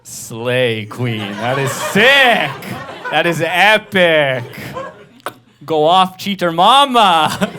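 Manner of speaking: loud gushing slow cheers; hyped friends. Retold or imitated. Friends hyping up the cheating girlfriend, loud and slow. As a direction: cheer her on like a fan. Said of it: "They go".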